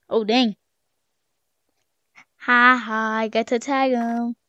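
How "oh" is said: usual speaking voice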